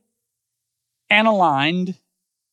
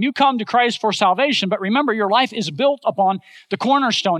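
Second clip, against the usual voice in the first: ordinary talk, nothing marked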